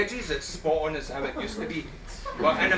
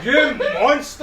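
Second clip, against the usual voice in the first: mock outrage shout; 8 semitones higher, 7 dB louder, much slower than usual.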